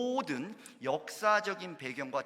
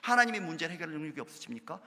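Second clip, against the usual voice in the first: usual speaking voice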